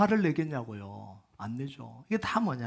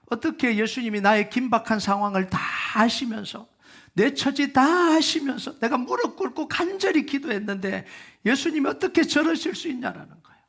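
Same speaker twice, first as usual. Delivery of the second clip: higher, louder, hurt and indignant pleading; a desperate father protesting to Jesus, higher and louder; voicing Jairus's bitter complaint; 6 semitones higher, 4 dB louder than usual. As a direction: let the betrayed father's resentment spill out. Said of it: "이런 마음들이 안 들겠어요?".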